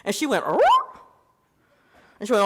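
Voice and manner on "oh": high startled squeak of a question; a sudden high surprised oh. Retold or imitated. She is caught off guard, high surprised oh.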